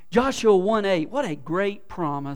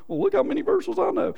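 smug show-off; 13 semitones higher than usual. Retold or imitated. Mocking show-off believer.

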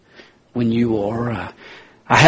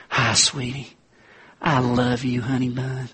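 tender sweet-talk; 7 dB quieter than usual. Retold or imitated. Husband greets wife tenderly.

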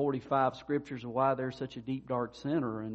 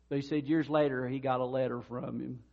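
usual speaking voice

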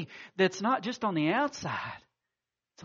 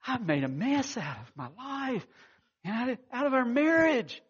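tearful confession; 7 semitones higher than usual. Retold or imitated. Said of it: "he just starts weeping".

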